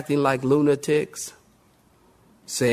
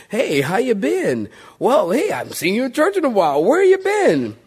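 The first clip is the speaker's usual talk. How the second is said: mock friendly greeting; 6 semitones higher than usual. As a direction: greet a long-lost churchgoer with exaggerated surprise. Acting out a greeting to absentees.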